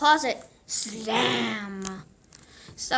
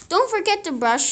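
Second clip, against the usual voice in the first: mother reminding; 8 semitones higher, 7 dB louder, faster than usual. A narrator's reading.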